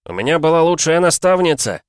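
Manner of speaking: loud, high, heartfelt cry; impassioned yell; shouted reply. A narrator's reading. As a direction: shout praise back through the storm. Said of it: "крикнул он в ответ".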